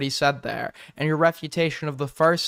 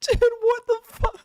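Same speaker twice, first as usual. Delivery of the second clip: much higher, shrieking disbelief; shocked disbelief. Real, not acted. Watching the clip in horror, high shocked shriek. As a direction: shriek it out, totally thrown.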